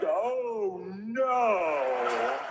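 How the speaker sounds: long drawn-out, sinking "oh no"; dawning dismay. Retold or imitated. Realizing he is bleeding on the lawn, drawn-out dismay. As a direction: stretch it out as it dawns on you. Slow horrified realization in underwear.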